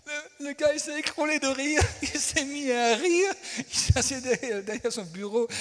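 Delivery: high, loud telling through laughter; laughing. Real, not acted. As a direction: tell it while cracking up.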